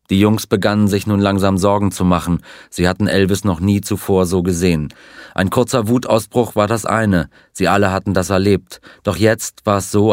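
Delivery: usual speaking voice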